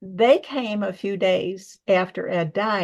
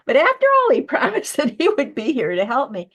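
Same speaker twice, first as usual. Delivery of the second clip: a hint of mock outrage, with a laugh; half-joking complaint; half-joking complaint about the friend who died before helping with her book; half-serious indignation; 6 semitones higher, 5 dB louder than usual. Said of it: "partly serious and partly not serious".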